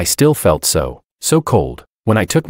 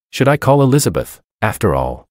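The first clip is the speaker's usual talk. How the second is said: usual speaking voice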